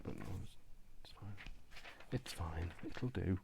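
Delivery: soft, under-breath reassurance; half-whispered it's fine; quietly talking yourself round; muttered to self. Real, not acted. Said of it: "that's not terribly straight".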